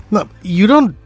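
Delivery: ordinary talk, nothing marked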